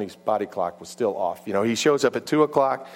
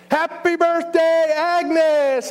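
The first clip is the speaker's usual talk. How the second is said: high, drawn-out celebratory shout; loud, stretched-out cheer; surprise party shout; 6 dB louder, much slower than usual. Acted. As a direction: yell it like a whole party greeting her. Crowd shouts surprise birthday greeting.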